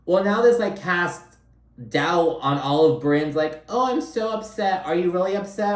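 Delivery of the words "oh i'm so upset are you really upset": high, sing-song mock of crocodile tears; mocking fake upset. Recapping Brynn's staged drama, a high mocking whine. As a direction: parody a fake-crying reality star, pitched up.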